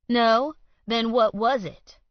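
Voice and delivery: loud, high sneering challenge; loud high taunting question; challenging. Acted. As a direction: push him for an answer, loud and high. Alan challenges Colt.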